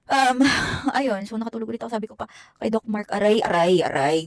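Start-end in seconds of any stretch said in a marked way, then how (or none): 3.2-4.2 loud, pained cries of aray. Retold or imitated. Patient waking mid-surgery crying out to Doc Mark, loud pained cries. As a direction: yell out aray as if hurting on the table. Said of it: "gumaganon pa ako".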